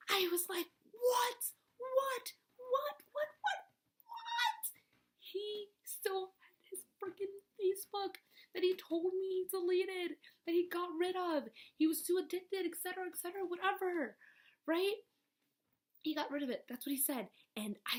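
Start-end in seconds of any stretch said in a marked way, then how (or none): 0.9-4.6 shrieking, ever higher whats; shocked repeated what. Retold or imitated. Reliving the discovery, shrieking in shock. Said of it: "I went berserk". 5.2-15.0 outraged rant. Real, not acted. Outraged rant about lie.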